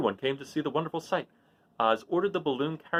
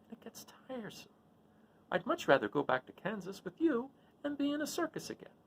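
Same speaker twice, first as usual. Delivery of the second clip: higher, weary little wizard; a humbug sighing over his shut-in life; the little wizard admitting his tricks to Dorothy; 8 semitones higher, 4 dB quieter than usual. Acted. Oz confesses he is a humbug.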